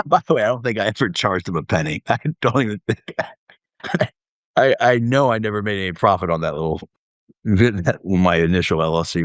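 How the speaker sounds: talking through chuckles; amused chuckling. Real, not acted. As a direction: laugh at yourself while confessing. Amused at never charging him.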